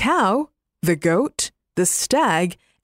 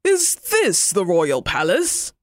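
exclaiming bear. Acted. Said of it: "exclaimed the bear".

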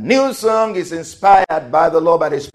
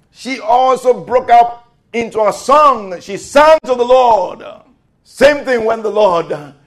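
high, loud, triumphant proclamation; full-force preaching; 7 semitones higher, 5 dB louder than usual. Acted.

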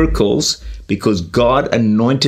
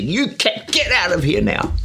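high, sharp command to get out; shouting at the cat; 9 semitones higher than usual. Retold or imitated. Man in his shed shouting the cowering cat out. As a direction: order the cat out, pitched well up. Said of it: "And he shouts at the cat".

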